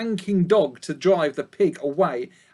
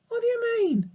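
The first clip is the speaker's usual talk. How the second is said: put-on very high young piglet asking; faster than usual. A narrator's reading. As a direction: ask anxiously as a small piglet. Babe asks.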